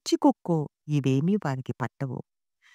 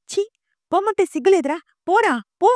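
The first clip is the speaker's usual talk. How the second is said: very high, loud, disgusted yell; screamed rebuke; 6 dB louder than usual. A narrator's reading. Mother who can't bear it driving her son away, screaming. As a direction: scream at him to get out. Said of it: "అరిచి".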